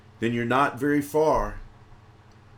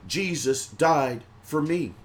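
ordinary talk

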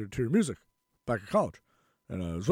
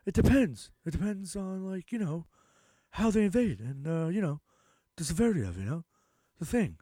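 mocking Biden; 6 semitones higher than usual. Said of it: "he's like".

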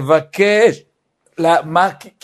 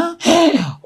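much higher mock gasp of horror; shocked gasp; 14 semitones higher than usual. Parent reacting to child hitting mother, mock gasp. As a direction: gasp as if appalled.

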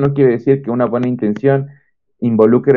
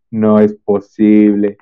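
dread; 4 semitones lower, 4 dB louder, slower than usual. Retold or imitated. Dread hearing grades read aloud.

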